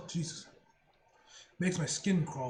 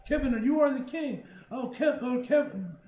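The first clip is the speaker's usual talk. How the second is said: loud happy praise; excited cheering; 6 semitones higher, 6 dB louder than usual. Real, not acted. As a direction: cheer him on loudly. Cheering a teammate.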